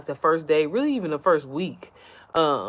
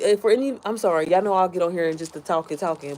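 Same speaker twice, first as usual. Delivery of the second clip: ordinary delivery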